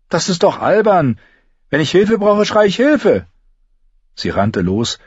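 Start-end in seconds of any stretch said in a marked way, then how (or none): 0.1-3.3 much higher, louder scoffing voice; indignant. Acted. Apprentice scoffs at the girl's plan, much higher and louder.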